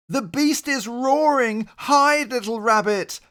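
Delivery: high, loud panicked cry to hide; shrieked warning. Acted. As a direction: scream it to save your child. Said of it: "Papa Rabbit shrieked".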